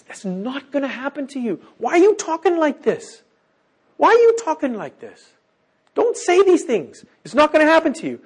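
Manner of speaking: high-pitched, pleading mock scolding; upset protest. Peter taking Jesus aside to object, high upset protest.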